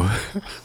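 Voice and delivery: sputtering cough on coffee; choking cough. Real, not acted. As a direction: cough as coffee goes down wrong. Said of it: "went down the wrong tube".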